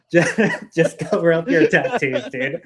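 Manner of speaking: laughing through the words. Real, not acted. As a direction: say it while cracking up. Laughs repeating the rule.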